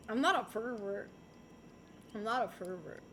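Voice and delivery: low, soft repeated denial; muttered self-reassurance. Real, not acted. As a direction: murmur it to yourself like a mantra.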